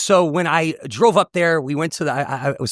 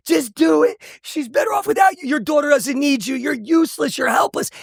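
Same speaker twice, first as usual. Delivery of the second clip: high, anguished self-berating shout, re-enacted; yelling at himself; 11 semitones higher than usual. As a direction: yell high and desperate, retelling an inner breakdown. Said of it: "I'm just yelling at myself, weeping".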